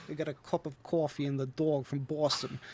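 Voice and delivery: a stock Boston line in a Boston accent. Shown as a demonstration. Showing off the one Boston phrase, Boston accent. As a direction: lean into the Boston vowels. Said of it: "I can only do those specific words in the Boston accent".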